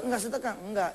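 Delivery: ordinary delivery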